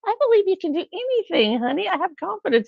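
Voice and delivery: high-pitched, hammed-up cheerleading wife; butter up a husband in a high lilt; gushing encouragement. Acting out encouraging her husband.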